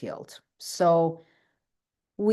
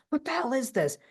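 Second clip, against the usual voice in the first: husband's outburst; 8 semitones higher than usual. Retold or imitated. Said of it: "Greg slams his fork down and he goes".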